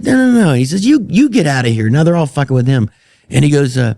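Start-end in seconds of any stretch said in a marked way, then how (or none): 0.0-1.9 mock shooing. Darren shooing him, retold.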